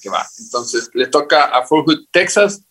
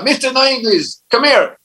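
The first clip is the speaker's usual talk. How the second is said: high barked drill-sergeant call, much higher; sergeant yelling; 10 semitones higher than usual. Retold or imitated. Sergeant yelling across the base for the recruit, high barked call. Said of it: "él gritaba".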